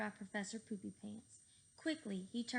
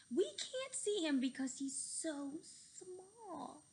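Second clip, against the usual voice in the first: slow awed; 7 semitones higher, slower than usual. Acted. Awed at unseen hero.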